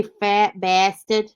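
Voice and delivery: drawn-out Boston accent; slow, broad Bostonian demo. Shown as a demonstration. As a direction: stretch it like a Bostonian, R dropped. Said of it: "if you can say".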